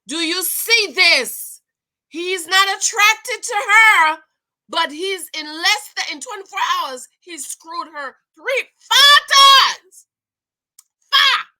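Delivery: slow, then high and loud on five; building outrage. Real, not acted. Talking to the ladies about the cheating, rising to a shocked shout. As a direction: build it up to a scandalized peak. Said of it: "Right, ladies?".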